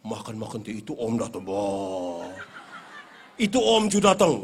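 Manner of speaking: low, deep mock-scary mother voice; mother scaring child. Mother frightening her son with the bogeyman, low mock-scary voice. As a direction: growl it to frighten a kid. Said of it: "Dia punya mama bilang".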